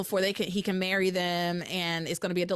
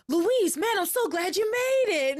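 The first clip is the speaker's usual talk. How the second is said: higher, louder, beaming hello; mock cheerful greeting, pitched up high; overjoyed greeting; 9 semitones higher, 4 dB louder than usual. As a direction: gush at an old friend. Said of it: "Antonio is like".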